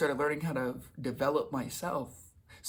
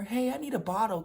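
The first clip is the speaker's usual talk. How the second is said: put-on high, pleading girlfriend voice; 8 semitones higher than usual. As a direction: ask sweetly for a favor, pitched well up.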